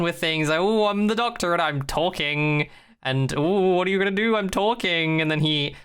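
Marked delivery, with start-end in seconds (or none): 0.6-2.7 mock Doctor chatter. 3.4-5.2 high, teasing sing-song; playful high-pitched imitation; mock Doctor chatter.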